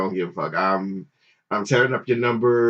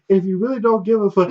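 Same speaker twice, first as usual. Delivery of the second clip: mock girlfriend voice; 9 semitones higher, 4 dB louder, faster than usual. Girlfriend voiced higher, fast.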